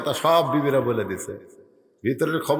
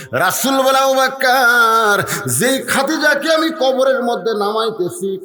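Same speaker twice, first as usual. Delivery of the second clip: full-force preaching; 9 semitones higher, 5 dB louder than usual. Acted. Preacher voicing the Prophet to Abu Bakr, full-force preaching. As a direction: stretch the name and cry it out high.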